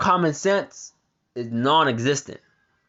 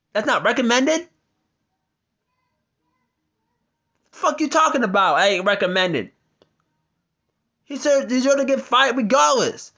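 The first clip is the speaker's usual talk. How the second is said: raised, heated rant, voice up high; angry rant; 6 semitones higher than usual. Real, not acted. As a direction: let the outrage boil over at the officer. Ranting at abusive officer.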